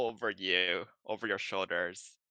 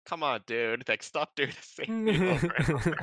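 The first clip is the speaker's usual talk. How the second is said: raised, louder mock complaint breaking into laughter; imitating fed-up partner; 7 semitones higher, 7 dB louder than usual. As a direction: plead as the exasperated partner, laughing through it.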